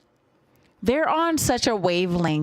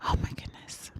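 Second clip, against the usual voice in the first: awed whisper; 11 dB quieter, faster than usual. Real, not acted. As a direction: whisper in awe. Awed, announcing the next track.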